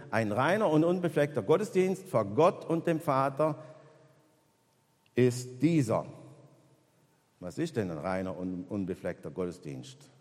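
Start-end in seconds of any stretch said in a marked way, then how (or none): 0.1-6.1 raised, louder solemn reading of the verse; scripture reading. Acted. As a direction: proclaim the verse higher and louder. Reads the Bible verse aloud.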